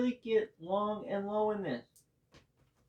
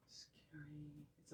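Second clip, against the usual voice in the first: whispering; 4 semitones lower, 23 dB quieter than usual. Real, not acted. Playful aside about hard pose.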